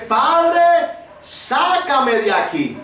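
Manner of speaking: high cry on Padre, then slow pleading; reliving the drowning cry to the Father; retold desperate cry. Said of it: "Y yo grité".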